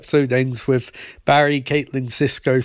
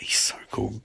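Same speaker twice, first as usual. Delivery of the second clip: hushed quote; 5 semitones lower, 9 dB quieter than usual. Retold or imitated. Barry gushing about Flash.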